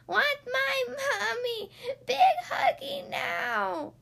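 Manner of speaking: very high, drawn-out loud wail; screaming baby. A narrator's reading. Baby insists on hugging Mommy, screaming. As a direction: stretch it into a toddler tantrum. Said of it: "screamed the baby".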